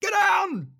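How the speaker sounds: loud, high mock commander's shout; retold yell. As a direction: shout it like an impatient drill commander, imitated. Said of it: "and he yells".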